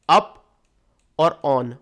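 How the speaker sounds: "up", a long pause, then "on"; split into two sounds. Shown as a demonstration. Teacher splitting upon into two sounds, piece by piece. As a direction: separate "up" and "on" as two syllables. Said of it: "दो sound निकल रही है".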